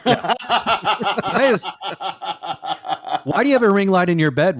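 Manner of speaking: high, loud question through laughter; laughing disbelief. Real, not acted. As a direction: laugh while demanding an explanation, pitched high. Teasing friend about ring light in bed.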